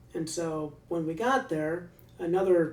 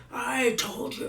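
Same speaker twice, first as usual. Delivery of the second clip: higher, mildly raised scolding; mock yell; 7 semitones higher, slower than usual. Guard turning back to scold the prisoner, higher pitched. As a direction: snap it at him. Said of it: "then just screaming and yelling at him".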